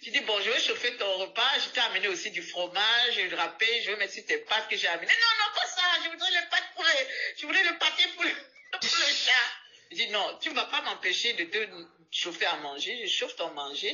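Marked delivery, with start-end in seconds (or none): 5.1-9.3 put-on high, laughing old woman protest; protesting old lady.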